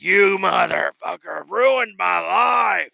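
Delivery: slow, loud raging shout; furious shout. Retold or imitated. Customer pinning the cook's hand in the press, slow raging shout. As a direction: spit each word out slowly in rage. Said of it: "I'm like".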